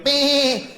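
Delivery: high little lamb bleat; dainty sheep bleat. Retold or imitated.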